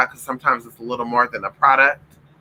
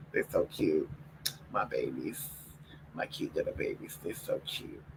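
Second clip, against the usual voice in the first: soft cooing; 11 semitones lower, 18 dB quieter than usual. Real, not acted. Owner coos over her sleeping dogs, hushed whisper. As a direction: murmur it almost breathless with love.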